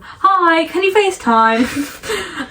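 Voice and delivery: high, drawn-out hopeful request; sing-song mock pleading to a friend; imitating own greeting. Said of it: "I start most of our conversations with".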